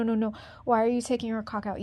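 ordinary delivery

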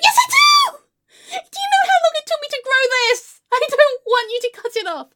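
high, loud, anguished outburst; screamed protest. Acted. Client silently begging hairdresser not to cut it, screamed protest. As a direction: start at a shriek, stay high and pleading. Inner protest against agreeing to short.

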